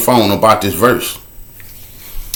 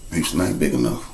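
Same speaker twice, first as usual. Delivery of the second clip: much softer, lower ominous refrain; hushed refrain; 11 semitones lower, 12 dB quieter than usual. Acted. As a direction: sink the line to a low hush. Repeated refrain.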